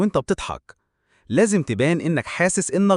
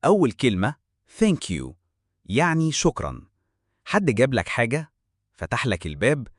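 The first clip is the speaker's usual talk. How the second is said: ordinary delivery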